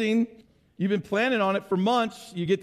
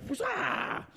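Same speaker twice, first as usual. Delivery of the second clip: high soft whooshing noise, much higher pitched; a quiet high-pitched whoosh of the spinning yo-yo; whoosh sound; 13 semitones higher, 7 dB quieter than usual. Retold or imitated. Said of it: "that whoosh-ah sound".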